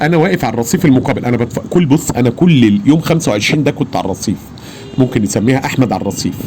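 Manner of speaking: ordinary delivery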